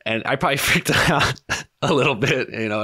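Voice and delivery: high, laughing through the words. Real, not acted. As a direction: chuckle it out nervously. Laughing at a grim memory.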